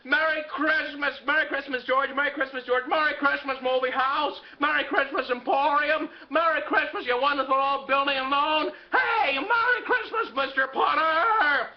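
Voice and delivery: high, drawn-out joyful shouts; jubilant yelling. Acted. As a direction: yell happy greetings down the street. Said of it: "yelling to people on the street".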